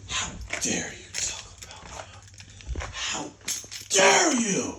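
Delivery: breathy mock whisper that jumps to a high cry on dare you; mock outraged fan. Imitating a fan outraged that his player is criticized. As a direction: whisper it, then squeal the outrage. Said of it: "Finna cry like a motherfucker".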